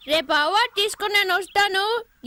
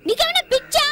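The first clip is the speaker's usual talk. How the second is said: incredulous outburst; 10 semitones higher, 4 dB louder than usual. Acted. Daughter shocked at father.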